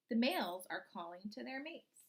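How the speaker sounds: put-on slow, expressive read-aloud for young children; storybook reading. Teacher reading a frog book to her class, storybook voice.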